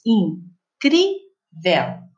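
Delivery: "Incrível" broken into three slow syllables, with pauses between; separado em sílabas. Shown as a demonstration. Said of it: "Eu separei as sílabas". ("Incrível" bem devagar, sílaba por sílaba)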